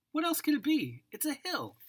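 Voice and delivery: usual speaking voice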